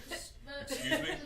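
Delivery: high, offended excuse me with a rising end; indignant surprise. Retold or imitated. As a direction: play the magician caught off guard, excuse spoken high and rising. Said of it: "And the magician was like".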